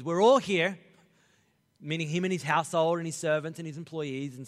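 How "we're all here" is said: loud eager declaration; Cornelius eager. Retold or imitated. Cornelius answering Peter, loud and eager. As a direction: announce everyone's present. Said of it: "He goes".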